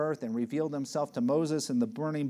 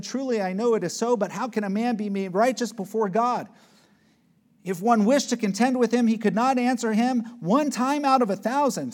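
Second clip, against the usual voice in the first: Job's words read loud and much higher; preaching scripture at full force; 8 semitones higher, 6 dB louder than usual. Acted. Preacher reads Job's plea to God, loud and high. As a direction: proclaim Job's lament.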